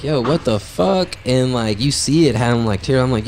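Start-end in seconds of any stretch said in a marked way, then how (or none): 0.0-1.2 shocked. Retold or imitated. Shocked by crying squirrel.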